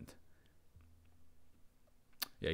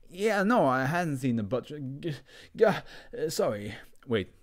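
nervous; 5 semitones higher, 4 dB louder than usual. Acted. Talking to hallucination.

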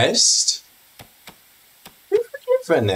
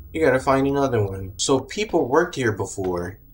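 normal talking voice

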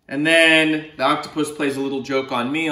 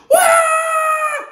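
high, loud shrieking cry of fright; frightened wail; 7 dB louder than usual. Acted. Boy seeing monsters in his closet, loud frightened wail. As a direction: let out a big scared wail. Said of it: "and he says".

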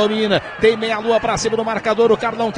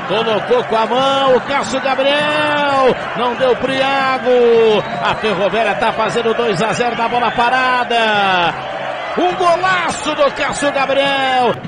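explosive goal commentary; 4 semitones higher, 4 dB louder than usual. Real, not acted. Celebrating goal.

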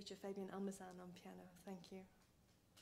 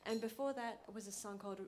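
ordinary delivery

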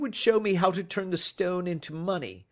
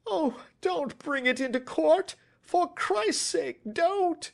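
shrieked pleading; 8 dB louder than usual. A narrator's reading. Culprit begs detective not to go to court, shrieking. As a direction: plead desperately, wailing each phrase. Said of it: "he shrieked".

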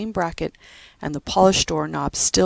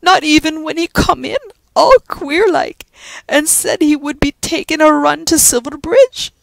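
high, loud Irish brogue full of lament; old Irish servant lamenting what she missed; grieving Irish housekeeper; 11 semitones higher, 8 dB louder than usual. Acted. Judy regretting she didn't stop Joe, lamenting in a brogue.

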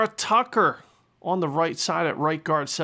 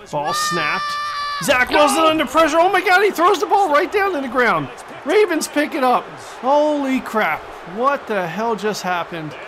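much higher and louder, yelling the play as it unfolds; real excited shout; 8 semitones higher, 5 dB louder than usual. Real, not acted. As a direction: erupt as the quarterback throws it away. Live commentary, big defensive play.

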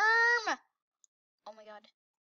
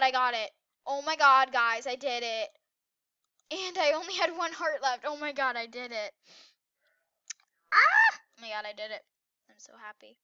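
ordinary talk, nothing marked

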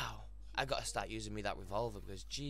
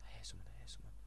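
hushed urgent whisper; whispering while sneaking up; 8 dB quieter, much faster than usual. Real, not acted. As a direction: breathe it so they can't hear. Sneaking near enemy.

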